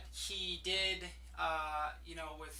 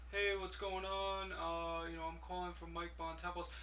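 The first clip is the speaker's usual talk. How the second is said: imitating a weak salesman, softer and trailing away; 2 semitones lower, 7 dB quieter than usual. Mocking a weak salesman's cold call, quiet and flat. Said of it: "he kinda sounds like".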